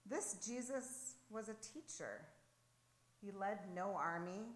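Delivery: usual speaking voice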